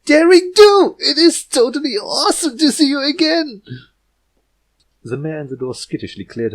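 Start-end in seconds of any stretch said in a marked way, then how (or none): 0.1-3.6 much higher, loud, brash fangirl squeal; brash excited tomboy. Acted. Rainbow Dash greets her idol, brash and thrilled. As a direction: gush at your hero. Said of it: "brash, sandy voice of Rainbow Dash".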